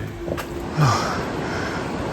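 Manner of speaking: long breathy sigh of exhaustion; tired sigh. Real, not acted. Vlogger sighing after climbing stairs to the lookout, long tired sigh. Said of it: "I'm exhausted".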